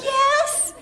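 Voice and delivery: high, loud laughing yes; proud yes. Retold or imitated. Wife answering husband about the bug, high loud proud yes. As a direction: declare it like a triumph. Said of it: "I said".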